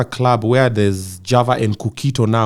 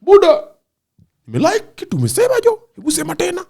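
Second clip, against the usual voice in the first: high, eager shout to a friend; excited high voice. Retold or imitated. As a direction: call out high and thrilled. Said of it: "Kajaro was like".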